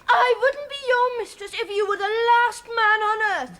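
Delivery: indignant, high-pitched tirade; angry shouting. Acted. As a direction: shout it in outraged protest. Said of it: "Now, stop shouting".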